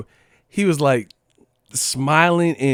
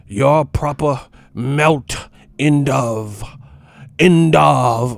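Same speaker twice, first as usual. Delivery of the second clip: mock British slang; 4 dB louder, much slower than usual. Mocking UK slang.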